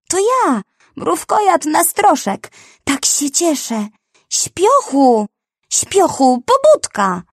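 anteater thrilled to wake his sleeping friend; excited anteater. A narrator's reading. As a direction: bubble over with glee. Anteater greets friend joyfully.

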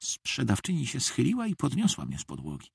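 plain reading voice

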